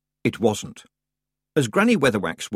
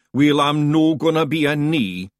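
louder, slow Scottish grumble; a stubborn brother refusing; gruff Feegle; 5 semitones higher, 5 dB louder than usual. A narrator's reading. Big Yan's voice.

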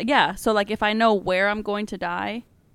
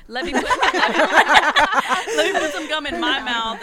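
laughing through the words; giggling as she says it; 6 semitones higher, 4 dB louder, much slower than usual. Real, not acted. Laughing while reaching for gum.

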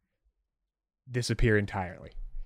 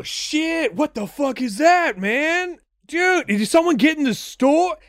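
much higher, loud surfer-dude voice; stoner bros; 6 dB louder than usual. Acted. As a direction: freak out like a clueless stoner. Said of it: "sound something like this".

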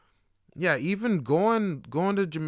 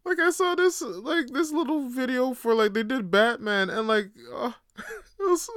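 high-pitched, breaking into laughter; can't get through it for laughing; real helpless laughter; 11 semitones higher than usual. Real, not acted. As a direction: try to talk while cracking up. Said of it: "I can't even keep my shit together".

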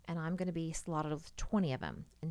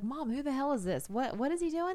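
kids imitated, alarmed; 9 semitones higher, 4 dB louder than usual. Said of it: "They'd be like".

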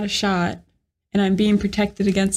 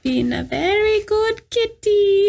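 put-on high, slow old crone voice; witch voice; 10 semitones higher, slower than usual. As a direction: purr it like a sly witch. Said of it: "I sound like an old woman".

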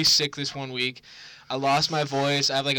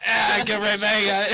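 higher, louder cry of protest; mock agonised yell; 5 semitones higher, 7 dB louder than usual. Retelling being tickled while crying.

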